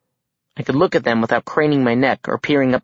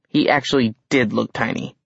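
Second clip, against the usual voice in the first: ordinary delivery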